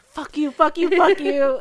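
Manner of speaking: loud, high-pitched acted tantrum; retold angry outburst. Child cursing alone in the bedroom, acted furious yelling. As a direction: yell it like a kid who slammed the door. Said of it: "slam the door, and go, like".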